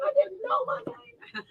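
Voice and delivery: slow, whispered words through laughter. Real, not acted. Whispering through laughter about forgetting her name. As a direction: breathe it out, laughing.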